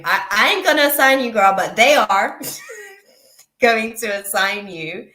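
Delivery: bursting out amused mid-sentence; amused correction. Real, not acted. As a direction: playfully set the record straight, laughing. Jokingly corrects the relayed words, laughs.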